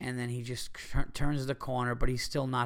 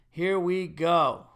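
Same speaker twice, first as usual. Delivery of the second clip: normal talking voice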